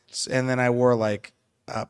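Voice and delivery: ordinary talk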